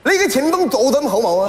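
mock-Cantonese navigation voice; imitated Cantonese. Sat-nav asks driver where he is, comic Cantonese. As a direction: put on a comic Cantonese accent. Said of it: "粤语的导航".

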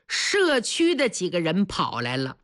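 plain reading voice

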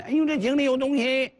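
high, loud cry of alarm; mock alarmed shout. Shown as a demonstration. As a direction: yell it out to lure someone over. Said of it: "你瞪眼珠子喊". (瞪着眼高声惊叫)

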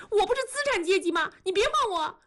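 high, rushed, indignant rebuff; hurt anger. A narrator's reading. Wife pushes husband away, hurt indignant rebuff. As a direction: fling it back high and fast.

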